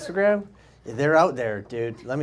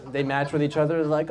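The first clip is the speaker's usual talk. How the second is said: usual speaking voice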